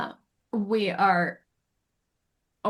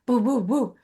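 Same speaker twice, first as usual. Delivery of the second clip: playful dog noises; dog boofing; 3 semitones higher, 4 dB louder than usual. Retold or imitated. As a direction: imitate the dog's little woofs. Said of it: "he goes".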